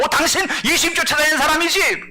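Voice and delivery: high, loud accusing voice; accusing bystander. Acted. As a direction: point the finger at him, high-pitched. Maid confronts Peter. (높고 큰 소리로 추궁하는 목소리)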